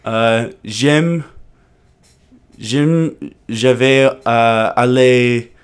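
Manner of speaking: slow, halting schoolbook French, English accent. Shown as a demonstration. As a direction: plod through it like a nervous English tourist. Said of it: "here's an English person doing French".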